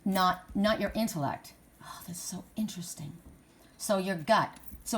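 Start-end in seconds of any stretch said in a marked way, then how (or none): 1.8-3.1 soft unvoiced whisper. Real, not acted. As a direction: breathe it out in a whisper. Whispered aside.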